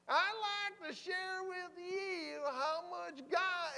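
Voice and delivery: high, loud, drawn-out Southern preaching; Randy Riddle. Retold or imitated. Friend preaching as subway trains empty the platform, slow Southern drawl. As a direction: crawl through it in an imitated Southern drawl. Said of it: "You gotta speak a little faster".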